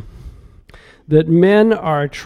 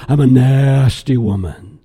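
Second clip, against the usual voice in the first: mock nasty woman; 6 semitones lower than usual. Mocking a rebellious protester.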